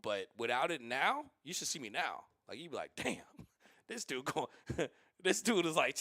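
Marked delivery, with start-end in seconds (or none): 3.0-6.0 laughing through an imagined onlooker's reaction; chuckling mock disbelief. Imagined viewer laughing at his restlessness.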